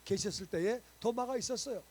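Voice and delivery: loud, fervent sermon delivery, pressing each point home; full-force preaching. Acted. Pastor preaching to his church, loud and fervent. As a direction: preach it out to the whole congregation.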